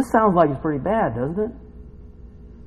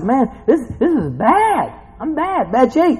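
high-pitched mock plea to God; lightly hammed-up man in trouble; desperate David; 10 semitones higher, slower than usual. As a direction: complain upward to God in distress. Voices David complaining to God.

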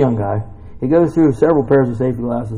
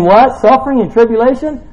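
higher and louder, outraged question; mock disbelief; 11 semitones higher, 7 dB louder than usual. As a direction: act shocked at the word "Suffering". Rereading verse in mock disbelief.

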